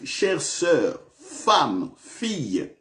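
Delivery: ordinary delivery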